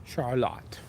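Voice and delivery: Charlotte with the weight on the final LOTTE; stress on last syllable. Retold or imitated. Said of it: "The emphasis on the last syllable".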